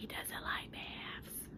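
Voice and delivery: whispered aside. Real, not acted. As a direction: confide it under your breath. Aside about the dog.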